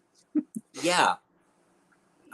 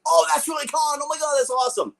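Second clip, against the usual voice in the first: mock excited fan; 13 semitones higher, faster than usual. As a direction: gush like a thrilled fan. Mock fan reaction quote.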